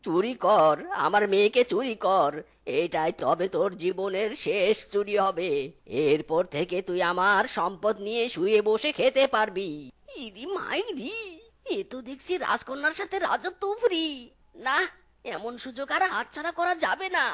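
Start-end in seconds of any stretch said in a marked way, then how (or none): 0.0-8.9 low, wheedling fox voice; coaxing old fox. Acted. Fox coaxes thief. 10.2-17.2 quiet, thinking-aloud aside; muttered aside. Acted. Thief thinks to himself.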